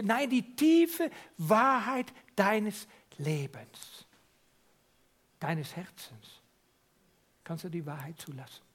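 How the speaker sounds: usual speaking voice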